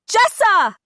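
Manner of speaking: loud, high call of the name; crying out. A narrator's reading. Dina crying the sister's name to reach Adira. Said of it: "Dina cries".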